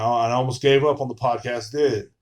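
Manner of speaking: usual speaking voice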